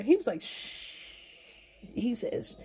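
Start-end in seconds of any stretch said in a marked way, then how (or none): 0.4-1.1 very soft, drawn hushing sound; urgent shush. Retold or imitated. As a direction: imitate him hushing her. He hushes her loud reply.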